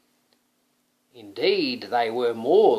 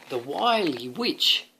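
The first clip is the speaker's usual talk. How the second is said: plain reading voice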